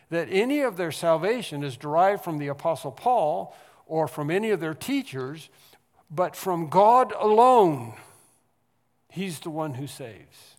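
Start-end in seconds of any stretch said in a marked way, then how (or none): 6.1-7.9 drawn out and louder, from God alone; loud, slow climax. Acted. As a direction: hammer home the close. Sermon climax.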